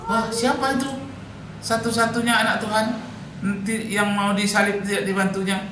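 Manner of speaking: high, surprised exclaiming voice; astonished question. Acted. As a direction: exclaim in disbelief, pitched well up. General reacts with astonishment. (seruan heran dengan suara tinggi)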